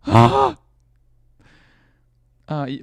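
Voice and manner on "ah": loud, high-pitched squeal; fangirl shriek. Pretend. Streamer squealing about Frank, loud high shriek. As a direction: let out a giddy scream. Excited shriek after swooning.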